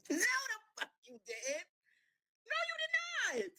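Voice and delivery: very high, soft, drawn-out squeak; incredulous squeal. Retold or imitated. Girlfriend denying she was ever told, squealing in disbelief. Reacting to his "I told you.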